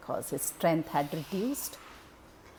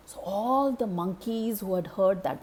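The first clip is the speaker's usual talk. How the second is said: usual speaking voice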